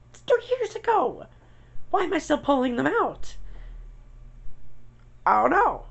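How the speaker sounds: a squeaky, fed-up outburst; exasperated squeak. Real, not acted. To viewers about bone shards, a high exasperated squeak.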